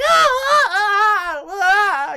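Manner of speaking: high wailing ah cries; mock crying wail. Terrified clerk wails at the robber, a hammed-up high cry. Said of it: "they're crying".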